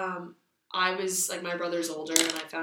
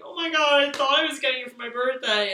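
put-on louder, higher upset kid whine; 6 semitones higher, 6 dB louder than usual. Child complaining the birthday gift never came, louder and whiny. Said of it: "I'm like".

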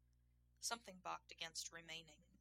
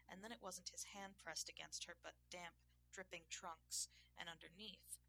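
ordinary delivery

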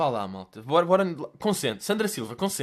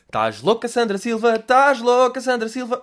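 high, loud exclaiming her name; loud incredulous call; 8 semitones higher, 8 dB louder than usual. Real, not acted. Vlogger calls out the influencer: loud disbelieving shout.

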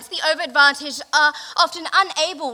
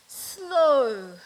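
slow demo. Shown as a demonstration.

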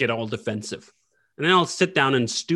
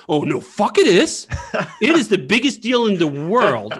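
louder, higher, indignant outburst; mock outrage; 8 semitones higher, 6 dB louder than usual. Customer snapping back at a belittling company in mock outrage. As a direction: fire back that it is a big deal. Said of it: "People will go like".

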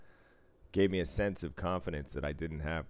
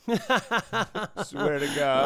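laughing through it, slow; 9 semitones higher, 7 dB louder, much slower than usual. Real, not acted. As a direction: swear it while still laughing.

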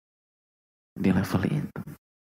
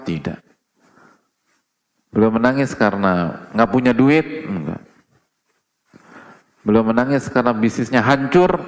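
rhetorical questions building louder and higher; preaching at full force; 4 semitones higher, 6 dB louder than usual. Acted. Preacher rousing the congregation with loud questions. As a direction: hammer each question home to the crowd.

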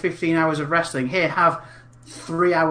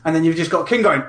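ordinary delivery

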